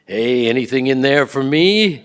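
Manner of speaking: calling out loud and jokey as the father; loud cheeky ask. Retold or imitated. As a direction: boom it out, half-joking, fishing for a part. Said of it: "he would say".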